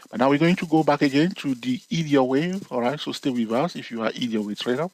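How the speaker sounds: ordinary talk, nothing marked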